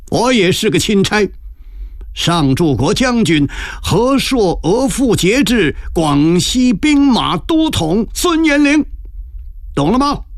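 high, ringing proclamation of titles; swaggering, mirthful boast. A narrator's reading. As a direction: announce your rank grandly, drawing it out high. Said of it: "孙延龄这才哈哈笑着说".

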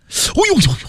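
scalded yelp. Acted. Heshen scalded by fresh plaster, high yelp of pain. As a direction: yelp as hot plaster burns skin. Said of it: "把和珅烫得一嗓子蹦起了老高啊".

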